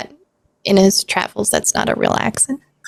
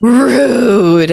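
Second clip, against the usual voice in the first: a sharp, louder mock-offended rude; NPC's indignant rude; 2 semitones higher, 5 dB louder than usual. Dice opponent scolding the player for deleting her fours, mock offence. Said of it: "as she says".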